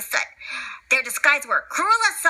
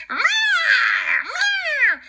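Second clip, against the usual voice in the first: crying meow; 14 semitones higher than usual. Acted. Cat in danger.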